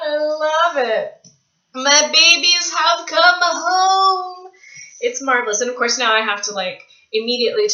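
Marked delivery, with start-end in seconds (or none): 0.0-4.5 slow, high, fond cooing; tender gush. Real, not acted. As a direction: coo to the books like babies. Cradling her new books.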